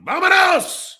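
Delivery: high-pitched exaggerated call; mock doorbell shout. Streamer re-enacting his doorbell camera send-off, mock shouting. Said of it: "you're looking at a doorbell and it's going".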